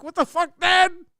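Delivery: high disbelieving What the fuck; rising stunned exasperation; incredulous. Retold or imitated. Son to dad after the South Park mixup, incredulous. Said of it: "And I'm like".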